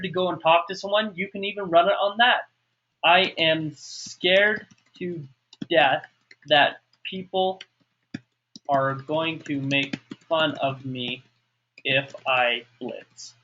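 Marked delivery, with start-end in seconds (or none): 3.0-13.3 very slow, broken into short chunks; dictating while writing. Shown as a demonstration. Don't judge my spelling tonight.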